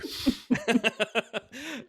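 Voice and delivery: high-pitched burst of laughter; real laugh. Real, not acted. Co-host confesses excitement about light bulbs, real high laugh. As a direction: crack up helplessly at the joke.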